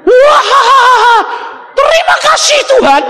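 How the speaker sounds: high, loud, laughing thanks; shrieking with relieved laughter; relieved laughing shout. Retold or imitated. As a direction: laugh out your thanks like a man just escaped. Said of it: "ketawanya itu kayak orang lolos dari cengkeraman setan".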